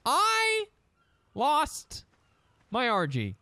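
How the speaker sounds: high, loud laughing cry of disbelief; shocked dismay. Real, not acted. Gamer realizes he lost his Argy, laughing in dismay. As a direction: blurt it out half laughing, half wailing. Sudden loss of pet, dismay.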